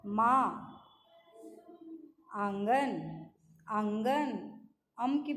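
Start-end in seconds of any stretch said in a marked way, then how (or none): none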